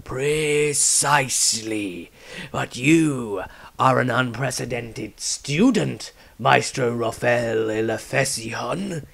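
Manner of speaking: very slow, grave elder's voice; the patriarch challenging Maestro, slow and grave; stern old patriarch. Acted. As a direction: weigh every word like a judge. Patriarch speaks.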